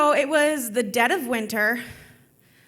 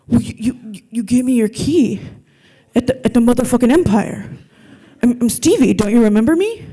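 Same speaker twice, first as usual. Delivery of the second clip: louder, dopey tough guy stammering; lightly voiced dumb thug; 6 dB louder than usual. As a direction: dim thug insisting he belongs. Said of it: "it's my dumb thug voice".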